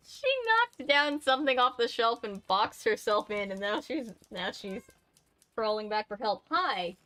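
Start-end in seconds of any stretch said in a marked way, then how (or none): none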